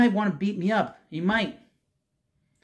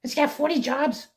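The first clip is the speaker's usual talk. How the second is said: much higher, worn-out voice imitating Boomer; Boomer impression; 10 semitones higher than usual. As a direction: mimic a radio host whose voice is shot. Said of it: "You know Boomer. He talks like this".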